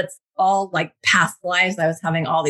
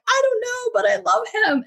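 much higher, squeaky helpless voice; mock-lovesick high wail; helpless confession. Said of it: "And I'm like".

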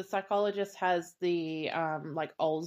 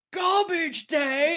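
high, slow, drawn-out holler; mock killer's yell; 8 semitones higher, much slower than usual. Ricky turns around with the gun, mock yell. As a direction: stretch the words out, hammed-up. Imitates the killer's yelled line.

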